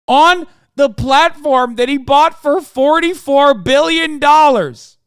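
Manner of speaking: building from tense to slow, loud, high fury; growing outrage. Real, not acted. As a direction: let the anger climb until each word is hammered out. Outraged rant about leaked health info.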